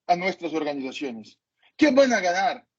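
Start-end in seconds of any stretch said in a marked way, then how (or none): none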